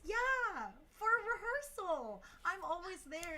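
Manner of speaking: mock squeal of eager agreement; imitated gushing fan. Fan promising to come to rehearsal, imitated as high and gushing. Retelling fan's delighted answer.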